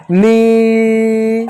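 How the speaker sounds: "नील" stretched very slowly into one long held note; long drawn word. Shown as a demonstration. Teacher drawing out the word for children to repeat. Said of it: "तो बोलो मेरे साथ".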